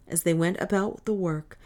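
usual speaking voice

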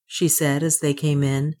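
usual speaking voice

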